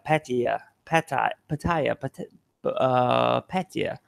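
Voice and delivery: says Pattaya several ways, unsure which is right; trying out pronunciations. Shown as a demonstration. Vlogger unsure how to say the city, testing versions aloud. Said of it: "however you say it".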